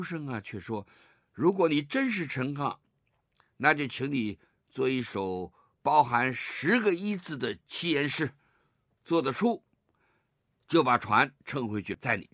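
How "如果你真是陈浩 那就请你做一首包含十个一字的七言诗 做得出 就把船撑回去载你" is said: challenging scholar. Retold or imitated. 书生出题刁难.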